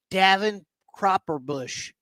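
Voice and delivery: slow, spaced-out delivery of Davin Cropperbush; slow name. Real, not acted. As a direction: draw out Davin Cropperbush slowly. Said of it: "You're saying it slow".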